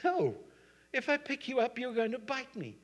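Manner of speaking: high, forceful acted refusal; emphatic refusal. Man turning down the pleading snake, emphatic refusal. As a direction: start on a loud no, stay high-pitched.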